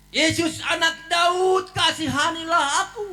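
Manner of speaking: high, slow pleading cry. Retold or imitated. Blind beggar calls out to Jesus, slow and high. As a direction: stretch each word out begging. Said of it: "langsung dia berseru".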